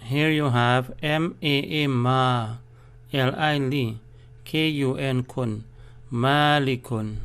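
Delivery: M A A ma drawn out slowly; teacher's slow spelling; slow spelling drill. Shown as a demonstration. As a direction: spell each syllable slowly, stretching the long a. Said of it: "there must be a stress".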